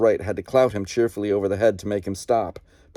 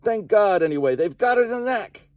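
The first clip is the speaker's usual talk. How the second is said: much higher, triumphant yell; yelling back; 12 semitones higher than usual. Acted. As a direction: shout it with grim relief. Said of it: "Tom yelled back".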